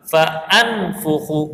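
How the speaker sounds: فانفخو stretched very slowly, long first a; teacher modelling فانفخو slowly; slow demo. Shown as a demonstration. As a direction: draw out فانفخو syllable by syllable. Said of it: "اب فانفخو اس کو نہیں پڑھیں گے".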